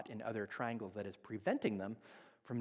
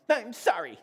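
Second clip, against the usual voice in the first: high, loud mock-child grudging apology; sulky child; 12 semitones higher, 13 dB louder than usual. Daughter forced to apologize, high sarcastic child voice. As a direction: spit it out like a pouty kid. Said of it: "child forced apology".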